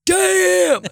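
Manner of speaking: a high, loud teasing "Damn"; mocking "Damn". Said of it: "make fun of that person and be like".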